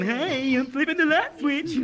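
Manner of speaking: high, mock cheery holler; goofy man calling out. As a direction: imitate someone waving and calling from a tower. Imitates man waving from the tower.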